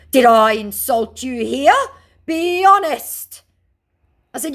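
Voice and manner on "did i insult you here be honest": high, loud put-on scammer; hammed-up indignant scammer; mock scammer voice. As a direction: demand it in a silly voice. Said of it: "scammer's reply".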